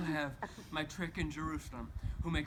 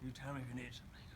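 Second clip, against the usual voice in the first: whisper; 7 semitones lower, 12 dB quieter, faster than usual. Acted. Whispered exit to Carla.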